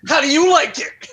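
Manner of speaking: put-on baby's punchline. Baby to his father, a cheeky punchline. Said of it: "The baby goes".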